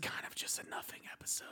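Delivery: breathy aside whisper. Real, not acted. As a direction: whisper it like a secret. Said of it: "Can you hear me when I'm whispering?".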